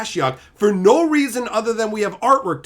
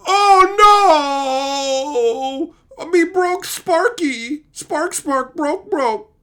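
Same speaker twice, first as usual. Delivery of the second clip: much higher, louder, fake crying baby talk; mock whiny Nahiri; 10 semitones higher, 7 dB louder, much slower than usual. Mocking Nahiri to chat as a fake-crying child. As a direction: ham up a wailing childish Nahiri. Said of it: "Nahiri just goes".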